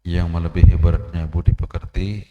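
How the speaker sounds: loud, slow pulpit recitation of the hadith; slow, loud sermon opening; forceful preaching. Acted. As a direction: proclaim it to the congregation, weighing each phrase.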